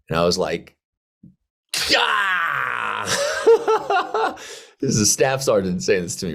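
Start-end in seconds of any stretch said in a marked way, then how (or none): 2.0-4.4 high, laughing yelp of shock; a hurt, half-laughing yell; stunned cry. Retold or imitated. Lieutenant hit by sergeant's blunt words, laughing cry. Reacting to harsh criticism.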